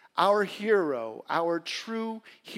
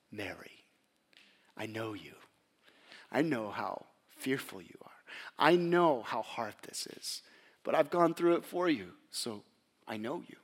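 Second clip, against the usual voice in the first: tender reassurance; 4 semitones lower than usual. Acted. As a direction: speak to her like a frightened friend. Risen Jesus speaks to Mary.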